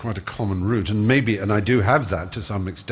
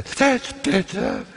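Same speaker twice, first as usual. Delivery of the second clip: put-on high, slow old-lady praise; drawn out and high like the old teacher; speech teacher praising the boy, high and slow; strange old lady voice; 12 semitones higher, much slower than usual. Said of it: "that sort of strange voice".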